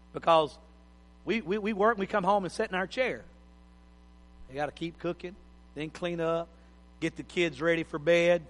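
ordinary delivery

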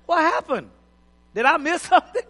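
high, loud comic bewilderment; hammed-up startled questioning; baffled alarm. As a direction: play the clueless man startled by tears. Acts a baffled man among criers.

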